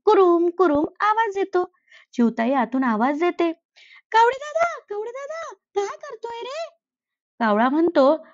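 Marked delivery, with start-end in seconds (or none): 4.1-6.7 much higher, softer little sparrow voice; sweet and busy, put him off gently; busy mother sparrow. Acted. Sparrow answers the crow while bathing her baby, high and sweet. Sparrow hears crunching inside.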